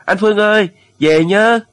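high, loud farewell cry; sudden yell. A narrator's reading. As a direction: yell after a friend sailing away. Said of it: "Tiếng thằng Mạnh bỗng gào lên".

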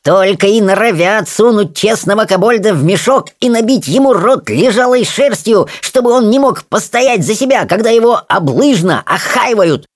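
high-pitched indignant tirade; fuming rant. Acted. Rolla complains of being stuffed in a sack, high fuming rant. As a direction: rant like an aggrieved little kobold. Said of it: "кипятился Ролла".

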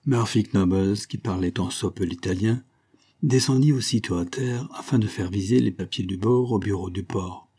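ordinary delivery, nothing marked

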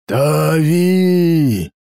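roared name, drawn out; roaring call. A narrator's reading. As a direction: roar her name. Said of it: "Рык Канторога был страшен".

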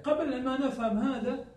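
ordinary delivery

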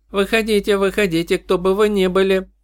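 high, eerie chorus of voices; eldritch horror. Acted. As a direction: demand it like a swarm of insect sisters. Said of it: "потребовали голоса, слившись в единый хор".

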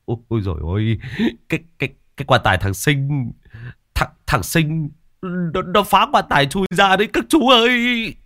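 terrified youth stumbling over his words; panicked stammer. A narrator's reading. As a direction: stammer in horror, climbing to a high wail. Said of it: "giọng hốt hoảng". (lắp bắp, giọng hốt hoảng)

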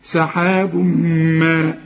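سحاب ما with the tanween merged into meem; idgham into meem. Shown as a demonstration. Teacher correcting a student's merge. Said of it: "ما انت بتقول له ما".

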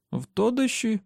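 put-on high-pitched boy echoing В Тодеші as a question; puzzled boy's query. A narrator's reading. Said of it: "перепитав Джейк".

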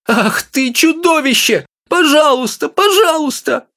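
very high, slow squealing plea; squeak. A narrator's reading. Wife stuck on the pedestal, squealing.

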